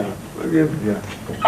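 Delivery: quiet, slow repeat. Acted. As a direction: let it sink away slowly under the breath. Worker repeats his line, fading.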